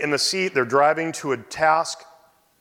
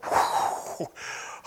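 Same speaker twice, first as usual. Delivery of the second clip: shaky sigh; 5 dB quieter than usual. Retold or imitated. Reacting to cancer news.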